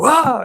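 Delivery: high mock gasp of shock; audience gasp. The audience reacting to the gunshot, gasp. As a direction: imitate the crowd's gasp. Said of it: "You heard the audience go".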